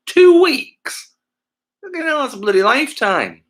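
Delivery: leaps up high and loud in mock outrage; can't believe anyone plans that far ahead; shocked disbelief. Real, not acted. Streamer reacting to a birthday two weeks away, loud disbelief.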